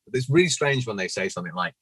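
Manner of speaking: normal talking voice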